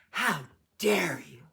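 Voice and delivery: loud, storybook outrage; indignant shout. A narrator's reading. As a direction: shout it as an offended lady. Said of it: "she shouted".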